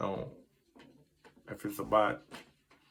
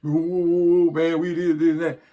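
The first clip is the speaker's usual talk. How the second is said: mock fan complaining; 6 semitones higher, 6 dB louder than usual. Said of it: "I used to get mad and be like".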